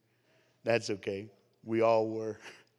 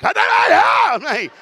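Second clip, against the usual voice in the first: very high, hugely loud mock yell; mimicked translator shout; 18 dB louder than usual. Translator echoing the preacher in German, imitated shouting. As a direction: yell it like a wildly fired-up translator.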